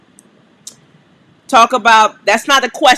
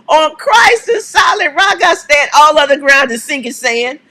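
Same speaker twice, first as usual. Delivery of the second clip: fired-up proclamation; 4 semitones higher than usual. Acted. Host shouting the hymn line to viewers, fired up. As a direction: belt "On Christ" like a church cry.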